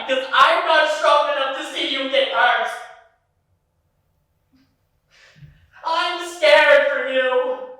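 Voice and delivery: loud, high, slowing on scared; anguished outburst. Retold or imitated. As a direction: blurt out the fear you've hidden all day. Boyfriend finally admits his fear.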